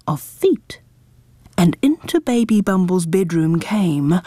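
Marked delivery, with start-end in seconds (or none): 0.1-0.8 whispering. A narrator's reading. Sneaky footsteps.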